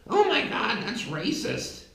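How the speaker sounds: hammed-up pearl-clutching gasp; mock outrage. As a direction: parody a shocked critic gasping. Mocking shocked leftist reaction.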